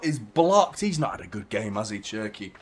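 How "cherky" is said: ordinary talk, nothing marked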